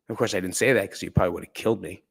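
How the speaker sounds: ordinary talk, nothing marked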